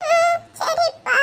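high, slow whimper; tearful. Acted. Son given money by feared father, tearful. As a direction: agree meekly, half crying. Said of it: "அழாம பின்ன என்ன பண்ணுவான்".

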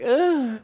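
short high-pitched "ah" scream; high, pushed little mock yelp. Shown as a demonstration. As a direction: give a quick sample shriek. Voicing a sample scream request.

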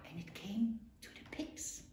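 usual speaking voice

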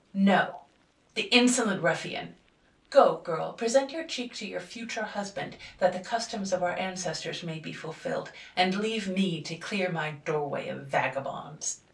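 loud, contemptuous orders; father commanding his daughter and scorning the intruder; angry command. A narrator's reading. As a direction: scorn the vagabond while ordering her. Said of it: "father orders girl, scorns intruder".

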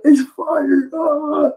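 very high yelp of alarm; Usopp panicking. Retold or imitated. Usopp spotting the fire, with a very high panicked shriek. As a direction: squeal it out in terror. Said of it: "And Usopp's like".

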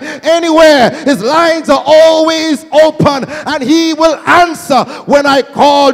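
loud, high testimony building to a shouted climax; impassioned pulpit crescendo; full-force preaching. Real, not acted. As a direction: drive each phrase harder until it is a shout.

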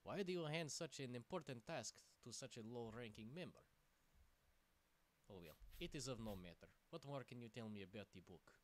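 put-on roleplay the lizard hero in a jokey Russian accent; light fake Russian accent. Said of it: "Buttface the Argonian is now Russian".

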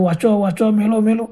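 ordinary delivery

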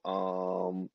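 long, low, quiet um; copying mom's stall. Retold or imitated. Daughter mimicking mom when she gets stuck, stalling. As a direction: drag out a thinking um. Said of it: "In the same exact way".

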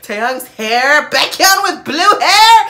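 loud squealing fan praise; excited gushing. Real, not acted. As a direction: gush over the new hair. Gushing over the blue hair.

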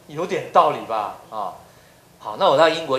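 usual speaking voice

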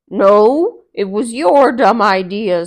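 loud blaming retort; angry bear. Acted. Bear quarrels with the fox, loud. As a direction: shove the blame back.

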